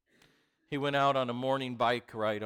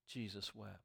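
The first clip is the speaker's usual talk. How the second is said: hushed; 3 semitones lower, 14 dB quieter than usual. Acted. Hushed scripture reading.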